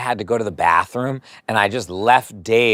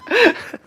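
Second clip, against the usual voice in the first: high laugh. Real, not acted. Laughing at the awful smell.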